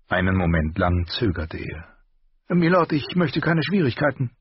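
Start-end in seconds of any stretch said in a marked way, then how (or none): 2.5-4.3 higher, sharper armourer voice; the master's voice. A narrator's reading. Armourer rebuking his apprentice, higher voice. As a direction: scold the boy in a raised voice.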